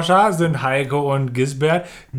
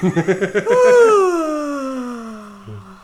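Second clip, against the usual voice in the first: loud laugh; 10 semitones higher, 3 dB louder than usual. Real, not acted. Bursts out laughing.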